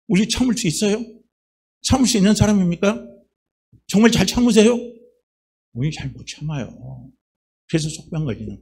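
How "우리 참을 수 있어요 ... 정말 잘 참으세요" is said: louder, higher challenge to the congregation; rising challenge. Real, not acted. Preacher pressing the congregation on holding back anger, louder and higher. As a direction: build each question up and out.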